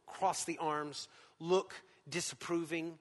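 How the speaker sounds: usual speaking voice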